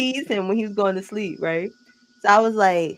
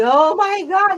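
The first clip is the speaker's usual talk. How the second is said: high, louder weepy wail; put-on tearful cry, pitched up; mock sniffly crying; 9 semitones higher, 4 dB louder, slower than usual. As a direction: reenact a sniffly, tearful outburst. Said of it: "sniffling, and I was like".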